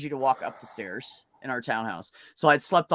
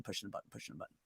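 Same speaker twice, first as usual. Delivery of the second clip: much softer and lower, trailing away; fading drowsy murmur; 7 semitones lower, 22 dB quieter, much faster than usual. Retold or imitated. Pressing the morphine button, fading into a drowsy trail-off. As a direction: let it drift off as the morphine hits.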